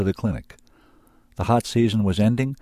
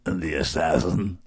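a huge beast judging its captive; Sorin beast voice; 2 semitones lower than usual. A narrator's reading. Sorin speaking.

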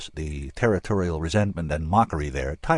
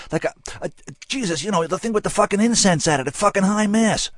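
high-pitched, agitated blurted account; Frank spilling it; 12 semitones higher, 4 dB louder than usual. A narrator's reading. Frank confessing to his interrogators what he saw, high and agitated. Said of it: "Frank just started spilling".

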